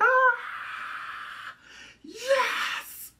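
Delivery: thrilled fangirl squeal; ecstatic cheer. Real, not acted. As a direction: celebrate the kiss like a fangirl. Reacting to the kiss scene.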